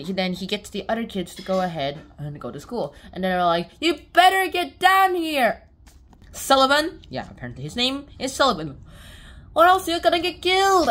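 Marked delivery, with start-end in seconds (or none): 3.8-7.0 loud, high, drawn-out yell for someone; hammed-up impatient shout; calling out. Other kids calling for Sullivan. 9.5-10.9 high, loud mock threat; hammed-up yell; shouted warning. Kids shouting a threat to Sullivan.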